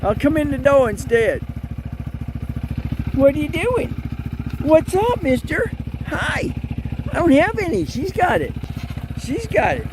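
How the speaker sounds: high, loud voice for the goat; voicing the goat. Woman voices the goat's thoughts in a high childish voice. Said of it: "He says".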